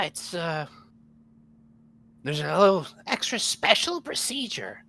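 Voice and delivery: a hint of a low gremlin croak; lightly voiced little gremlin. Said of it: "Gremlin voice and Mickey Mouse voice".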